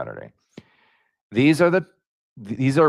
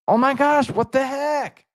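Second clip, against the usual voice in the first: shocked. Real, not acted. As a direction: react in real disbelief. Shocked reading stream feedback.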